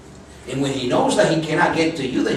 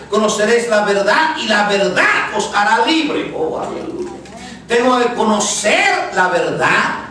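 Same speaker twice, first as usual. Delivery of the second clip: preaching at full force; 5 semitones higher than usual. Acted. Preacher urging the congregation to know the truth, preaching hard. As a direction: hammer each line home to the church.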